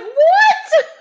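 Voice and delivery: very high, loud laughing squeal; laughing disbelief. Retold or imitated. Dreamer retelling her own shocked reaction, laughing.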